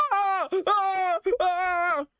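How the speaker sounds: short, very high, loud shrieks; mock terrified screams. [name] running from Bonnie with the bag, mock terrified screams. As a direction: scream in repeated hammed-up bursts.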